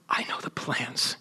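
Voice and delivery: hushed whisper of God's promise. Acted. Preacher whispering God's promise to exiles. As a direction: breathe it like a secret.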